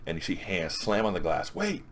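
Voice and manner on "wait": high-pitched, softer "wait"; mock urgent plea. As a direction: imitate a sudden plea through glass. Desperate call through the glass.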